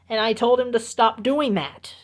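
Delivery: usual speaking voice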